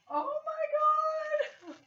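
very high, slow, loud crying wail; tearful cry of joy. Real, not acted. Crying out with joy at the stuffed bird. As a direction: break into happy tears. Overcome by the gift.

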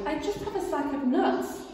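small and scared; timid squirrel. Acted. Squirrel answering the rat, high and timid. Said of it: "the squirrel replied".

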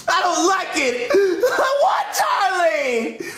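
slow, drawn-out tearful whine; bawling tantrum. Acted. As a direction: wail it like a spoiled child. Tantrum over cancelled show.

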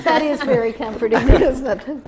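laughing through the words. Real, not acted. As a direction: say it while laughing out loud. Laughing at the joke.

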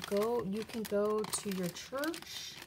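ordinary talk, nothing marked

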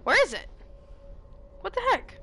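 high, loud exasperation; frustrated surprise. Real, not acted. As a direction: blurt it out, baffled. Can't find the shotgun.

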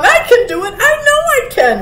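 high, loud, quickening insistence; eager fawn. A narrator's reading. Fawn volunteering to go, high and insistent. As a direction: insist excitedly, climbing in pitch. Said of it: "volunteered the fawn".

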